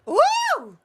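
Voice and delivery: loud, high cheer; excited whoop. Real, not acted. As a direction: let out a big whoop. Cheering a sexy moment.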